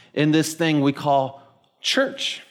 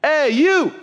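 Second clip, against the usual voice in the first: high, louder call across the field; hollering; 11 semitones higher, 4 dB louder than usual. Retold or imitated. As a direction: call him out from afar. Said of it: "he hollers over at me".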